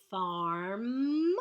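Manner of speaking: "Farm" with the R drawn out; stretched out sounds. Shown as a demonstration. Teacher sounding out the syllable for spellers, stretched. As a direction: stretch "Farm" so the R pattern is heard. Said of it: "You should hear that R pattern".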